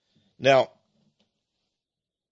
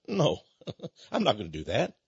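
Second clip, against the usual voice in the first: quiet, softer, calm and unbothered with a small laugh; calm refusal; 6 semitones lower, 7 dB quieter than usual. Retold or imitated. John calmly refusing the devil's offer, quiet and at ease. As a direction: turn the devil down calmly, almost amused. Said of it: "And John just calmly said".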